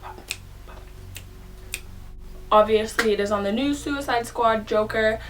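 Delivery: talking around a mouthful of foil; slightly muffled, foil over the teeth; tinfoil in mouth. Real, not acted. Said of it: "If I'm talking a little funny, it's 'cause I got tinfoil in my mouth".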